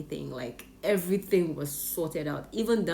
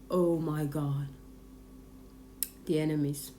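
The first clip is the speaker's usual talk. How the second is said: ordinary talk, nothing marked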